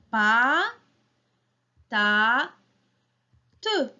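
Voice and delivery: "Pa, ta, te" as three separate beats, first two drawn out and rising; split into syllables. Shown as a demonstration. Teacher counts with children, syllable by syllable. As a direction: chop "Pa, ta, te" apart with a pause after each. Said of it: "trois syllabes".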